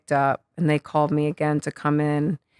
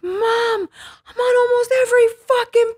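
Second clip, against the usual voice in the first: much higher, louder, slow, near-crying yell; ecstatic; 5 dB louder, slower than usual. Retold or imitated. Daughter yells good news to mom from phone booth. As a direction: scream the news with joy, half in tears.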